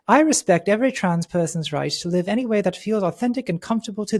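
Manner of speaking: put-on English accent, measured reading; J K Rowling. Host reading the last tweet, English accent. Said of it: "I'm gonna switch to doing an English accent".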